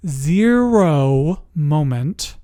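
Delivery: "zero" drawn out slowly with a swooping rise and fall; emphatic stretched ZERO. Real, not acted. Storyteller stressing what she witnessed, "zero" drawn out. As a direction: stretch "zero" long and weighty.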